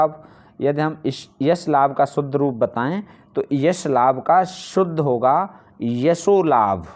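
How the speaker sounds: ordinary talk, nothing marked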